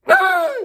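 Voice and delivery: hammed-up horrified high shriek; a sudden fake scream of horror; mock shriek.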